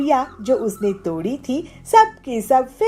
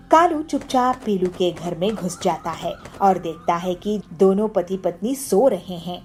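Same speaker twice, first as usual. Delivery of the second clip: usual speaking voice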